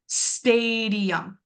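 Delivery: "stadium" with the first A stretched very long; long stressed A. Shown as a demonstration. Teacher showing students the stressed syllable, long A in "stadium". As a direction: draw out the STA in "stadium", slowly. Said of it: "very long and it's a little bit louder".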